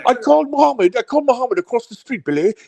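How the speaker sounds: high-pitched put-on caller taunting Billy; hammed-up high-pitched tattling; mock streamer impression. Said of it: "he's probably yelling right now on his stream".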